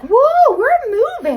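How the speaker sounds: high, loud whoop as giddy bristles; excited party bristles. Pretend. Playful brush demo for viewers, excited party voice. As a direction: voice the brush hairs whooping with glee. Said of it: "These bristles are like".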